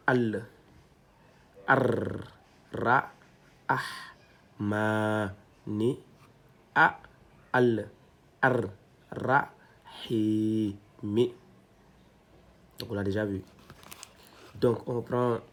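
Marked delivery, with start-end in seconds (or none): 0.1-11.3 each syllable said apart, clearly; careful Arabic syllable drill; spelled Arabic syllables. Shown as a demonstration. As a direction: read out the syllables slowly one by one. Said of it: "Donc, c'est A".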